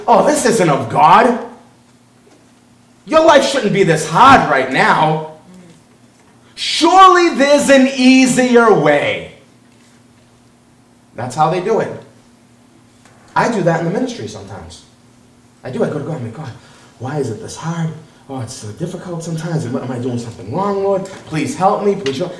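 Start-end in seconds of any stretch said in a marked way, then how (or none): 0.1-9.3 slow, drawn-out mock persuasion; persuasive false teacher. False teachers tempting struggling believers, mock-persuasive. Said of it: "false teachers come in and they say". 16.3-22.3 soft, low mock-plaintive prayer; imitated whining. Preacher reenacting his own prayer to God, whining softly. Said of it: "Stop whining".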